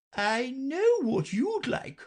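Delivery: very high, slow, good-natured queen. A narrator's reading. Queen offers Alice a treat, high and good-natured. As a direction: offer it kindly. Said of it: "good-naturedly".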